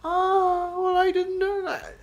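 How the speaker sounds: high squeaky mock-wounded cry. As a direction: pretend to be deeply hurt by the correction. Mock hurt at being corrected.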